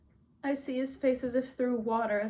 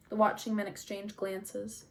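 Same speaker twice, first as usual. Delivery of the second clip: ordinary delivery, nothing marked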